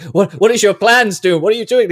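high, loud startled heroes; alarmed shout. Retold or imitated. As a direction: yell in confusion. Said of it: "they're just like".

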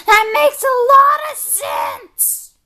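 high, slow, shouted revelation; exaggerated realisation. Friend reacting to makeup explanation, hammed-up realisation. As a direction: stretch it out as a grand discovery.

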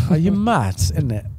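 loud high roadman slang, joking; London roadman accent. Pretend. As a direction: throw it back like a London roadman. Echoes the catchphrase.

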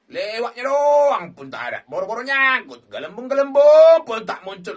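loud, exasperated yelling at the game; frustrated shout. Real, not acted. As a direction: shout your frustration at the screen. Frustrated at slot not paying. (teriakan kesal)

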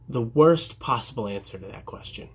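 ordinary talk, nothing marked

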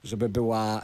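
usual speaking voice

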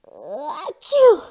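big loud acted sneeze. A narrator's reading. Jasper sneezing mid-sentence, loud and acted. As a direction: explode into a sneeze. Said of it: "Jasper sniffled".

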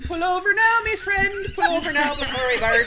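hammed-up Irish accent, half sung; mock Irish drinking song. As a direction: belt it like a tipsy Irish pub tune. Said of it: "sing in an Irish accent".

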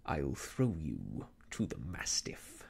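low, slow, whispered menace; raging threat. A narrator's reading. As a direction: hiss the threat through a grin of rage. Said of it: "the smile hardened into a grin of rage".

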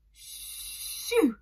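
breathless whispered "Shoo"; faint whisper. A narrator's reading. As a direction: barely dare to shoo it away. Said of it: "more faintly".

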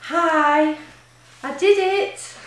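loud, high burst of joy; elated runner announcing she finished; excited. Real, not acted. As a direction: shout the news, thrilled.

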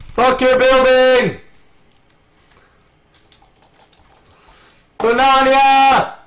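very high, loud, drawn-out holler; gleeful battle yell. Pretend. Player attacking a building in game, drawn-out battle yell. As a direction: holler it slowly like a war cry.

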